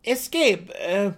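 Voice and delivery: high, loud gnome voice; explaining gnome. A narrator's reading. Seldig explains the word to the dark elf, high and loud. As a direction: spell it out for the foreigner. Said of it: "Seldig explained".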